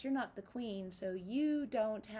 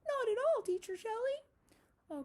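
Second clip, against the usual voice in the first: high, curious little spider; puppet spider voice; 13 semitones higher, 6 dB louder than usual. Acted. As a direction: squeak it as a friendly puppet spider.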